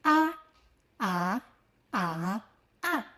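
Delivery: "A, a, a, a" said in first, second, third and fourth tone. Shown as a demonstration. Teacher demonstrating the four tones of "a" to learners. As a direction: step "a" through all four Mandarin tones in order. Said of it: "I mentioned there are four tones".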